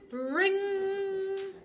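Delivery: loud, high alarm ring. Acted. Alarm clock going off.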